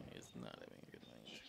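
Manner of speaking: whispered agreement through laughter; quiet laughing. Real, not acted. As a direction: murmur it, still chuckling. Echoes, laughing, under breath.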